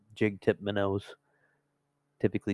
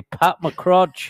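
loud, higher mock TV reporter name; 9 semitones higher, 7 dB louder than usual. Introducing a joke St. Patrick's reporter, loud and higher. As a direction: announce the joke persona. Jokey Irish reporter persona.

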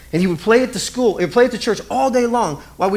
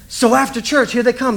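ordinary talk, nothing marked

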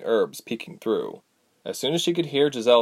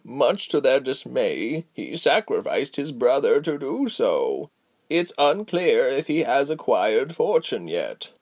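slow, measured elder's voice; slow wise old woman; 4 semitones higher, slower than usual. Acted. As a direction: speak like a patient old sage. Giselle's slow voice.

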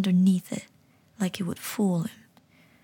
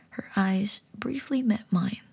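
plain reading voice

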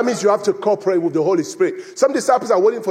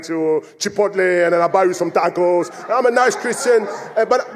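ordinary talk, nothing marked

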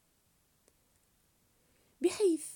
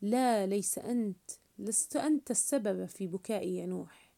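ordinary delivery

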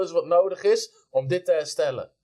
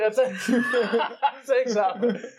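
higher, laughing through the words; 7 semitones higher, much slower than usual. Real, not acted. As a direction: giggle out the cheeky thanks. Repeats joke while laughing. (lachend uitgesproken)

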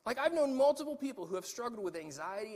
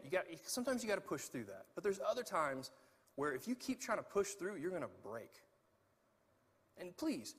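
usual speaking voice

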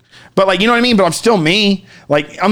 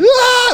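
much higher yelp of shock; high startled scream. Retold or imitated. Yanked into the sky, screaming. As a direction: shriek as if yanked upward. Pulled upward.

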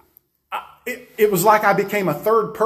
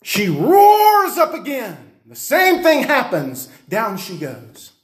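high, louder, slow preaching; preaching fire; 6 semitones higher, 5 dB louder, slower than usual. Real, not acted. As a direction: build the story to a peak. Preacher building his story.

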